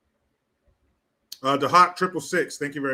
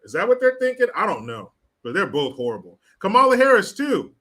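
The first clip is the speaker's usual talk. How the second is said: normal talking voice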